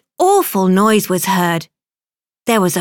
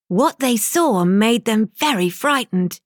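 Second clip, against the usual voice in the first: ordinary delivery, nothing marked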